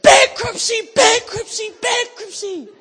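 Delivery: high, full-force chanted shout; repeated scream. Retold or imitated. Head against the building, praying, repeated scream. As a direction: scream it over and over like a decree. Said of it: "screaming this".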